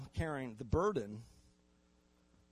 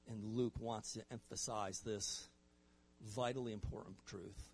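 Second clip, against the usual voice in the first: soft, lower voice with long pauses; hushed solemnity; 4 semitones lower, 6 dB quieter than usual. Real, not acted. Preacher telling the congregation he's dead, hushed and solemn. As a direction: let each phrase fall quietly and gravely.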